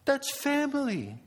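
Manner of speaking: high, slow and gentle; loving parent. Retold or imitated. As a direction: fondly accept the family's fights. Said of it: "They would say".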